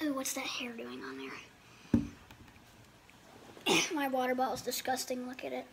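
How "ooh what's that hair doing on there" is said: whispering. Real, not acted. Spots hair on food.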